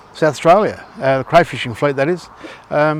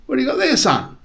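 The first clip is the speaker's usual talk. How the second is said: put-on old codger's call, pitched way up; high old man voice; 11 semitones higher, faster than usual. Old man on porch calls to boy, high voice. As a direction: squeak it out like a nosy old man.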